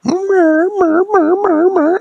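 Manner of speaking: loud, very high repeated panic cries; terrified noise. Acted. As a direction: squeal in short cartoon bursts. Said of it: "And he was like".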